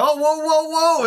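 high, alarmed whoas. Retold or imitated. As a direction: back off in alarm. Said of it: "and it's like".

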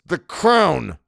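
high, loud angry retort. A narrator's reading. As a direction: snap back in anger. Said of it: "I said angrily".

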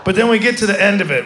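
ordinary delivery

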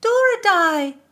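high, loud wailed name; wailing. Acted. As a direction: cry out her name in alarm. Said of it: "Christina wailed".

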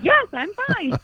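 put-on high, chirpy little-baby voice. Baby after rolling over, squeaky and high. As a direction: voice the baby showing off his trick. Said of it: "was like".

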